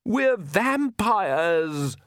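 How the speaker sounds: high, very drawn-out cry; exasperated, high-pitched slow shout. A narrator's reading. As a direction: stretch each word in a high shout. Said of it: "Lacrimosa shouted".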